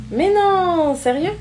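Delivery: high, incredulous squeal of surprise; excited disbelief. Acted. Friend hearing the boyfriend arrives in minutes, surprised.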